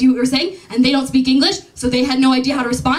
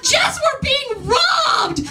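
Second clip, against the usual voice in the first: much higher, slow drawn-out alarmed yell; panicked shout; 10 semitones higher, much slower than usual. Retold or imitated. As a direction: cry out to your friend in panic. Panicked call during apparent robbery.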